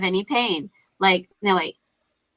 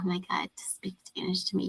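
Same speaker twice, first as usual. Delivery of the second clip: unvoiced, much softer whisper; 4 semitones lower, 14 dB quieter, faster than usual. In labor, whispering her complaint about the assistant midwife. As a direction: breathe it as a secret aside.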